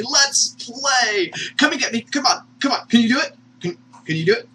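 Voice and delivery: high, quick playful goading; excited high-pitched dare; playful taunting. Acted. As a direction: tease like a boy daring a tiger to play. Playful voicing of boy taunting tiger.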